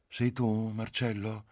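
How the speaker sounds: high, languid wife's voice; caressing, languid. A narrator's reading. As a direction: caress the name, letting it soften. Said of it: "la voce carezzevole, languida della moglie". (voce carezzevole e languida, in tono alto)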